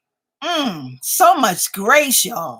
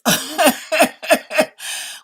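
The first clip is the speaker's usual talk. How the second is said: laugh; 5 semitones higher than usual. Real, not acted. Laughing at bell bottoms comment.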